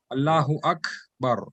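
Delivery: slow "Akbar", K trailing into air before "bar"; hams on kaf. Shown as a demonstration. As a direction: let a slight kha follow the K in "Akbar". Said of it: "it has a little".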